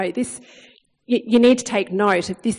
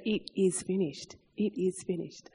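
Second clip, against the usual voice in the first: hushed inner voice; 2 semitones lower, 11 dB quieter than usual. Retold or imitated. Words heard from God, hushed.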